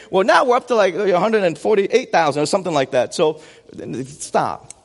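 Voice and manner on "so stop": ordinary talk, nothing marked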